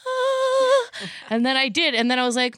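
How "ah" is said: very high held "ahh"; awestruck "ah". Retold or imitated. Player finding the perfect weapon, high awestruck "ah". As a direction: sustain it high like a revelation.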